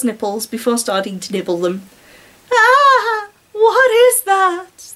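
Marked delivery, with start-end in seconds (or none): 2.5-4.9 high, loud cry, then a slow question; pained cry. Acted. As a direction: cry out, dazed and hurting. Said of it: "the girl mumbled".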